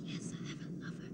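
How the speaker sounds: hushed unvoiced confession; whisper. Antigone confessing to her nurse in a whisper. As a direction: breathe it out secretly.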